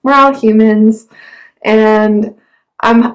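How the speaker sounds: ordinary delivery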